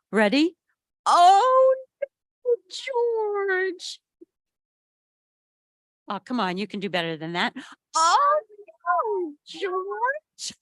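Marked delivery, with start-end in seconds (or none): 1.1-4.0 high, loud dismayed cry. A narrator's reading. Scolding the dog in story. 8.0-10.5 dismayed oh no. A narrator's reading. Scolding the dog again.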